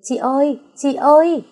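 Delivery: hét gọi chị, cao và to; cất giọng thật cao, reo gọi chị; tiếng hét gọi. A narrator's reading. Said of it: "có tiếng hét lên". (hét gọi "chị ơi" thật to)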